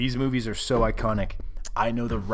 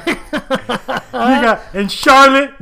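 usual speaking voice

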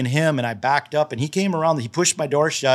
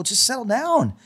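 urgent placating; 10 semitones higher than usual. Retold or imitated. Calming the angry man down.

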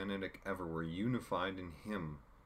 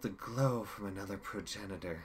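villain Chaos; 3 semitones higher than usual. Acted. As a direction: savour each word like a gloating villain. Chaos voiced in audiobook.

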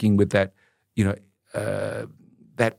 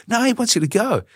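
retold high-pitched insistence; insistent; 12 semitones higher than usual. Cousin presses a reluctant guest to visit the rooms, insistent. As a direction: push back on a refusal, pitched up.

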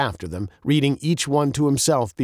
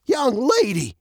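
put-on high-pitched old man taking offence; mock offended; 12 semitones higher than usual. A narrator's reading. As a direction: address her as if affronted. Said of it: "as if offended".

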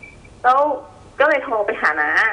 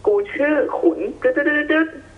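mock spirit voice; 6 semitones higher, slower than usual. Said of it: "เขาพูดว่า".

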